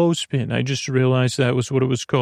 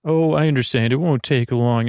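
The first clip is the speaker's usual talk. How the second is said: usual speaking voice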